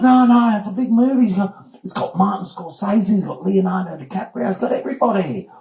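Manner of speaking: high, eager protest; excited insistence. Retold or imitated. Doug defending the movie to a doubter, insistent.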